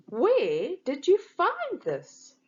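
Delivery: high, slow, admiring question; excited. A narrator's reading. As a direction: gush over the new shirt. Excited question about a shirt.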